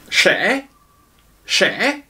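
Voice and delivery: "che" as a high clear sh; "che" chuintant, aigu; sh sound demo. Shown as a demonstration. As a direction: model "che" hushed and high. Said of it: "se prononce comme un che".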